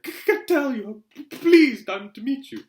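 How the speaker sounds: high-pitched, stuttering professor; nervous stammer. A narrator's reading. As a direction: trip anxiously over first consonants. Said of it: "stammered Professor Quirrell".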